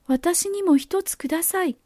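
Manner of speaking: put-on pleading little girl. A narrator's reading. Girl begs for persimmon.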